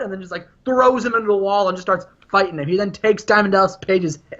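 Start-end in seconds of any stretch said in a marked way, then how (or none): none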